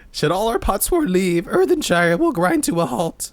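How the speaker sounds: high creature voice. Acted. Ready Rock NPC dialogue voiced.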